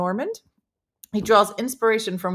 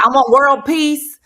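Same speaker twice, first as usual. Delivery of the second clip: loud, slow, higher Southern pageant voice; Southern accent; 5 semitones higher, 10 dB louder, slower than usual. Pretend. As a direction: draw it out Southern, big and loud. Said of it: "that was a little more Southern than I thought".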